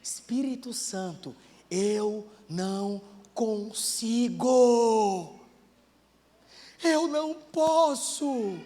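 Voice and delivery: slow, pleading prayer; humble prayer of surrender; sincere plea. Shown as a demonstration. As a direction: confess your weakness slowly. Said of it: "experimenta dizer pro Espírito".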